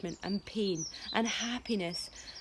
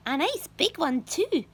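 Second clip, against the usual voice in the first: greedy aunt; 7 semitones higher, 6 dB louder than usual. A narrator's reading. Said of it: "Aunt Spiker said".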